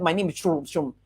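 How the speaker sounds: quick, swallowed name, hard to catch; rushed mumbled name. Shown as a demonstration. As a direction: mumble the name fast and low. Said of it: "They just heard".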